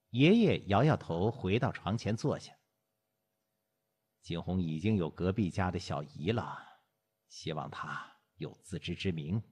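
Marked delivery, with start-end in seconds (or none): 4.2-9.4 put-on low, softer, troubled old man; lightly voiced grandfather. Grandfather confiding a worry to his wife, low and soft. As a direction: murmur it low, with a touch of worry.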